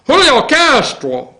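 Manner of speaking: ordinary delivery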